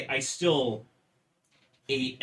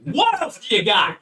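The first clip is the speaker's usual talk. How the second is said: laughing demand; 9 semitones higher, 10 dB louder than usual. Retold or imitated.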